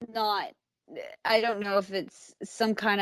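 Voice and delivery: usual speaking voice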